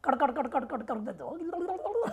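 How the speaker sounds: mock rattling of pebbles in a tin; pebble rattle imitation. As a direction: rattle it out like pebbles in a box, half laughing. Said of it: "उसे road से कंकड़ उठा कर डाल दो".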